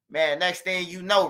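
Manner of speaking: ordinary delivery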